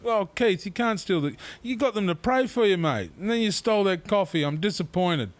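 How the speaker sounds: high, pleading mock scolding; disappointed reproach. Telling Keith he robbed those who prayed, high disappointed reproach. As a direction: reproach a mate, easing down at the end. Retold reproach of coffee thief.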